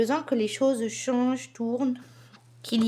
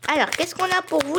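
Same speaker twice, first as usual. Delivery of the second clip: ordinary talk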